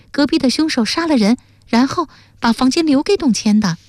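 put-on high, eager girl's voice; high-voiced excited reasoning; impatient, eager. A narrator's reading. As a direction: jump in keenly with the theory, pitched high. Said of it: "忙说".

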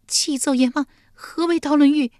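high-pitched, loud frightened plea; trembling with fear. A narrator's reading. Yulian questioning the King of Hell, trembling with fear. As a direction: ask it in a high, fearful voice. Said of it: "玉莲战战兢兢地问道".

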